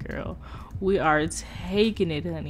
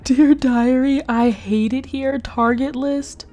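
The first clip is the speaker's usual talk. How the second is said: mock diary voice; 4 semitones higher, 6 dB louder than usual. Reading the diary in a voice.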